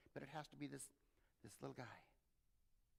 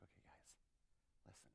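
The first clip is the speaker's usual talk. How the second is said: soft whisper; calm parent; 20 dB quieter than usual. Acted. Parent pulling the kids together, soft whisper. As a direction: hush them gently together.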